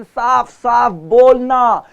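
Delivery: slow, loud, every syllable crisp; clear articulation. Shown as a demonstration. Teacher showing students clear speech, slow and loud. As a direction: spell out each sound very slowly. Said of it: "articulation".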